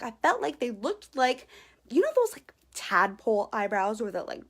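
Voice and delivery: ordinary delivery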